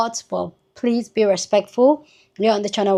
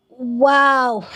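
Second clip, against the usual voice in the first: ordinary delivery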